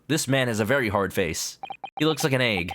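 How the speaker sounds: ordinary talk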